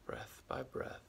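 guided meditation. Acted. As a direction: guide the listener gently back to the breath. Leads a guided acceptance meditation.

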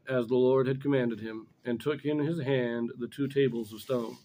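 normal talking voice

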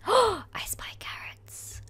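high, thrilled whisper; excited whisper. Pretend. Player spotting carrots in Annie's farm, excited whisper. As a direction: whisper with sudden glee. Finds carrots while sneaking.

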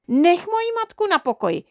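very high-pitched outraged mother; angry shout. A narrator's reading. Mother defending her own mother, angry shout. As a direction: cry out in furious defence. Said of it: "křičela maminka".

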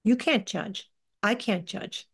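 ordinary talk

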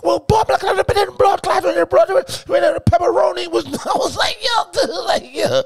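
high, loud put-on goofy voice; hammed-up high imitation of a buddy; mock high voice of a rambling friend; friend impression.

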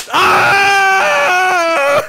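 high, drawn-out yell; battle scream. Acted. Hero in a fight with villain, high scream. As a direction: let out a long high holler.

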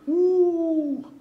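high, loud whoop; loud delighted woo; cheer. Real, not acted. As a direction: whoop for the wife. Cheering his wife.

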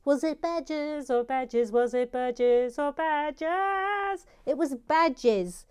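mock-dramatic build, slowing at the end; dramatic quizmaster. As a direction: stretch the question out for suspense. Suspense question in quiz round.

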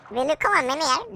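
ordinary delivery

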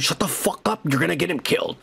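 ordinary talk

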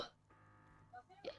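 usual speaking voice

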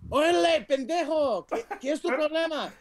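high, hammed-up Mexican Spanish; Mexican Spanish accent. Imitating dad's family for friends, high Mexican Spanish accent. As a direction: challenge someone in exaggerated Mexican Spanish. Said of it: "they talk more like this".

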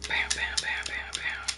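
voiceless, soft gunshot imitation; playful hushed shooting noises; whispered sound effects. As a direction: whisper rapid shots under your breath. Imitating shots under breath.

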